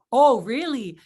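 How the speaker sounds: loud, swooping show of amazement; excited. Shown as a demonstration. Rep on the phone with manager before customer, excited. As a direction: act thrilled at the news. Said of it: "you need to be like this".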